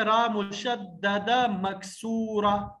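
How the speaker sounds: slow, carefully spelled term; teacher stretching out the term; slow clear naming. Shown as a demonstration. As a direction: say each syllable deliberately. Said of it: "اس کو کہتے ہیں".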